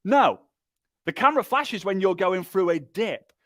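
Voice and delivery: usual speaking voice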